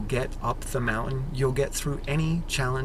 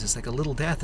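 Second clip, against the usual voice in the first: ordinary talk, nothing marked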